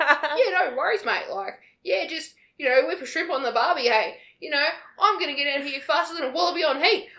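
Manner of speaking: hammed-up Aussie bloke, laughing through it; mock Australian accent. Mocking the Australian soldier in a hammed-up Australian accent. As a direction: lay on a thick Australian accent. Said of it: "the guy who had an Australian, Australian accent".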